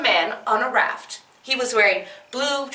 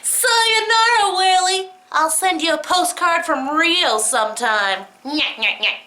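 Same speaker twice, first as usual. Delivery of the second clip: taunting; 3 semitones higher than usual. Acted. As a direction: tease the whale on the way out. Man taunts whale.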